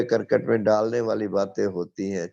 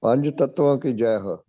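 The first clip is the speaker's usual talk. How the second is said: loud, raised devotional cheer; rallying slogan; 4 semitones higher, 5 dB louder than usual. Real, not acted. Hailing the five elements before the congregation, a loud slogan call. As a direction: proclaim it to the whole gathering.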